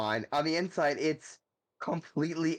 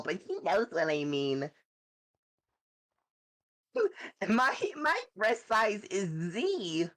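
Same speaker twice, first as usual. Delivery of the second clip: high ditzy airhead voice; high, bubbly bimbo character; 5 semitones higher than usual. Pretend. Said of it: "love doing my bimbo voice".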